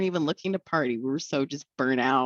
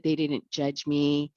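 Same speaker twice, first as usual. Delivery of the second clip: ordinary delivery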